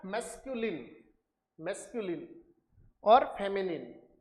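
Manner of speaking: मैस्कुलिन and फेमिनिन ending in a short "lin" and "nin"; corrected pronunciation. Shown as a demonstration. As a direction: carefully model मैस्कुलिन, फेमिनिन with a short i, not "eye". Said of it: "The correct pronunciation is". (मैस्कुलिन, फेमिनिन के अंत में छोटी इ के साथ "इन" बोलें)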